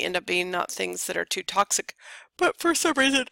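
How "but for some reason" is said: half-yawned words; yawning. Real, not acted. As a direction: say it mid-yawn. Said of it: "I'm really sorry, all of a sudden I'm yawning".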